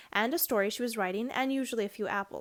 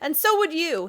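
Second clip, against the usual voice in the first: louder, higher outburst; upset boy; 6 semitones higher, 9 dB louder than usual. A narrator's reading. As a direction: push back hard, hurt and loud. Diggory upset, arguing back.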